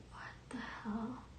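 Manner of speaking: hushed, voiceless whisper to oneself; whispered retold reaction. Sleeper to herself, hearing snoring beside her, whispered. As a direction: whisper it, as if puzzled in the dark. Lying awake puzzled by mystery snoring.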